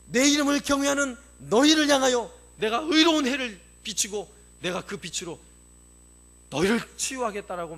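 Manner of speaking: loud, high preaching voice; climbing sermon cry; fiery preaching. Acted. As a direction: preach it out with full fervour. Preacher proclaims God's words.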